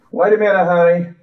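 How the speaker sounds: mom calling. Retold or imitated. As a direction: sing it out like mom at the door. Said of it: "Mommy would holler".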